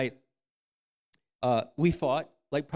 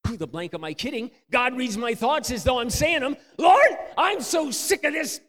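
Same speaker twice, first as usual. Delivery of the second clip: fed-up outburst; 11 semitones higher, 10 dB louder than usual. Retold or imitated. Man alone in the woods, crying out to God, louder and higher. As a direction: let the frustration explode. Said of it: "I'm just like".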